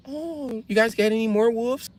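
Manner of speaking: mock a sweetly clueless dog lover in falsetto. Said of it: "She'd be like".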